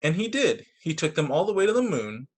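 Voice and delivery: put-on lively storybook voice for kids; bright read-aloud narration; reading to children. Reads picture book aloud.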